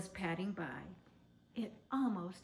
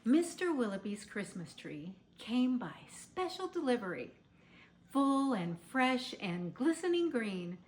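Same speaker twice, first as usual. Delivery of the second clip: lively read-aloud, building louder as he dashes downstairs; storybook reading; 2 semitones higher, 4 dB louder than usual. Acted. Mrs. Claus reading a Christmas book to children, lively storybook voice. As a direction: read it like a picture book to little ones, growing louder.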